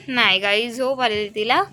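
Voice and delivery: ordinary delivery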